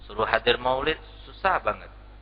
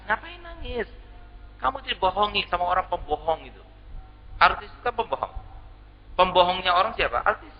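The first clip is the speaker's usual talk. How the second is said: mock scolding; 6 semitones higher than usual. Preacher scolds TV viewer mockingly.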